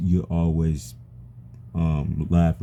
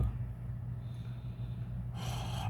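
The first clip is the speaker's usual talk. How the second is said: long breathy sigh of frustration; heavy sigh; 18 dB quieter than usual. Real, not acted. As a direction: let out a long defeated sigh. Struggling to say something painful.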